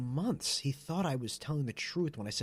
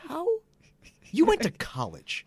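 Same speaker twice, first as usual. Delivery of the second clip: higher, louder disbelief; slow, baffled reproach; can't believe he fell for it; incredulous retort; 14 semitones higher, 4 dB louder, slower than usual. Retold or imitated. Acted disbelief at gullible brother.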